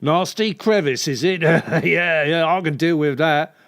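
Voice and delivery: leering builder, played for innuendo. Builder asking if the client's alone, suggestive. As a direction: turn the repair job into a come-on. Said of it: "builder not doing it for you".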